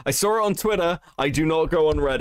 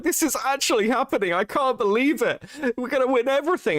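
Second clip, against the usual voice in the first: higher, gushing disbelief; imitating an excited friend; 9 semitones higher than usual. Friend Dally on the stream, gushing near tears. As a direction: mimic a friend overwhelmed with joy. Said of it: "nearly in tears".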